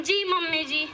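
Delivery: ordinary talk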